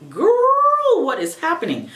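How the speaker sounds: put-on high, loud disbelieving "Girl"; mock outrage at her own tears; incredulous. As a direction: shriek the girl, then ask in disbelief. Talking to herself while crying.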